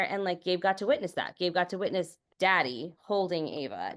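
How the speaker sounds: usual speaking voice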